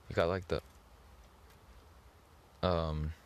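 ordinary talk

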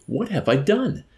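high-pitched, desperate question; frantic. A narrator's reading. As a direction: plead frantically to know. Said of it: "persisted the chaplain more frantically".

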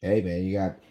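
ordinary delivery

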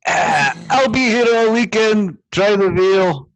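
milk the room like a lounge comic; stand-up comic sign-off. Acted. Shrek closing a mock comedy set, comedian voice.

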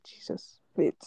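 hushed, whispered disbelief; retold stunned whisper. Retold stunned reaction to the news.

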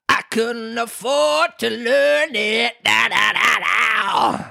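high, slow, mournful croon; sighing Mock Turtle. A narrator's reading. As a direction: sigh it out as a lament. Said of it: "with a sigh".

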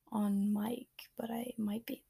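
hushed, half-joking aside; whispering. Real, not acted. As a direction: drop to a whisper on mic. Jokes about whistling on mic.

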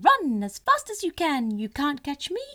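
high, loud, taunting chant; gingerbread man voice. Acted. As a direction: tease them with a high sing-song.

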